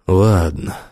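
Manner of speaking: slightly hollow, subdued concession; dull, muffled. A narrator's reading. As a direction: give in with a dulled voice. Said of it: "глухо сказал он". (глухо, нехотя)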